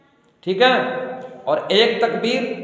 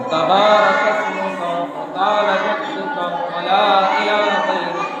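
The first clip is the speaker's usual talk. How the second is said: prayer recitation; slower than usual. Shown as a demonstration. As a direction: intone the opening praise gently. Recites the Sana. (धीमे सुर में सना पढ़ना)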